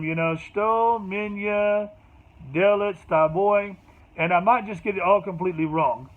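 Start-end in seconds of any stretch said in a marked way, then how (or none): 0.4-3.8 shto, minya, delet, staboy strung word by word, slow and choppy; no rhythm. Shown as a demonstration. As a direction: piece the words together stiffly with no flow. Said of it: "I don't have any rhythm".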